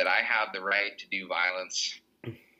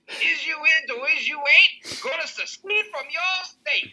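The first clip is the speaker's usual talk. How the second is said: much higher, slow loud wailing rally cry; hammed-up politician; 6 dB louder than usual. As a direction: wail the plea to the crowd like a stump preacher. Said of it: "just up there going".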